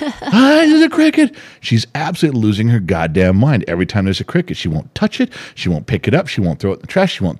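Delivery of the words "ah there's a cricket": high, squealing fright; mock panic. Grandpa imitating granddaughter spotting a cricket, high mock panic. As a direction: squeal like a scared little girl.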